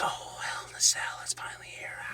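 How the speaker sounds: put-on hushed ghostly child whisper; creepy kid voice. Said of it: "creepy kid voice".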